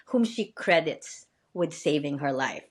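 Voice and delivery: ordinary delivery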